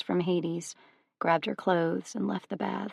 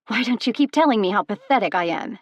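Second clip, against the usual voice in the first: fast, louder, higher bitter jab; spitting bitterly; 3 semitones higher, 7 dB louder, faster than usual. Acted. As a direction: spit the words out in scorn. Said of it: "she spat".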